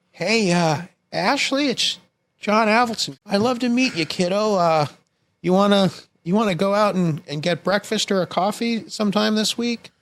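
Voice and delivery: high-pitched imitation of a father's phone message; hesitant, high impression of a dad leaving voicemail; John Avildsen. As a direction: put on dad's voice, far above your own. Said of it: "there's a Beverly Hills message".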